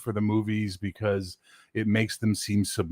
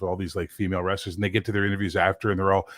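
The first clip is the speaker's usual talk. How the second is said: normal talking voice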